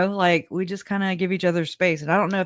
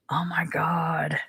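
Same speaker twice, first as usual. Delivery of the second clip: whisper; 5 dB quieter, slower than usual. Real, not acted. Reacting to confusing court stream.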